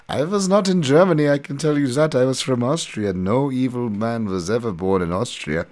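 put-on German accent. The German coach hands out skates, in a hammed-up German accent. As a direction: play the shady German coach in a mock German accent. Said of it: "with his thick German accent".

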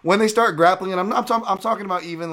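getting worked up; excited. Real, not acted. Excited rant about grappling.

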